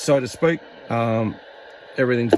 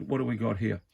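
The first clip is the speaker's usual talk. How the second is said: ordinary talk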